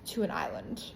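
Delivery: ordinary talk, nothing marked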